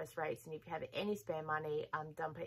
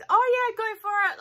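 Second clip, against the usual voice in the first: much higher and louder, rushing to agree; eager blurt; 14 dB louder than usual. Retold or imitated. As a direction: cut her off with an overeager yes. Blurts yes to the neighbour.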